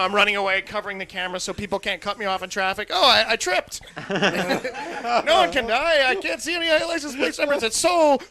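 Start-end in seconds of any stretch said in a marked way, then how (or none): 2.9-8.2 much higher, louder panicked babble; hammed-up panic; mock frantic whine. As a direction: blurt out excuses while fleeing. Acting out running away.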